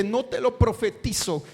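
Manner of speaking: usual speaking voice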